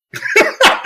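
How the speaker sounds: high airy laugh; burst of laughter. Real, not acted. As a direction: laugh out at your own jab. Laugh after challenge.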